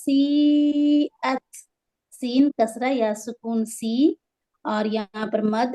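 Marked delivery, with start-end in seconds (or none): 0.0-1.4 سیئت with the ee held three to four beats; chanted, higher سیئت with a long ee; long madd. Shown as a demonstration. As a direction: stretch the first vowel of سیئت, then stop crisply on at. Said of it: "تین سے چار حرکت لمبا کریں گے".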